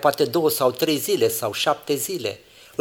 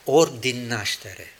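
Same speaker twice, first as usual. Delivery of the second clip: usual speaking voice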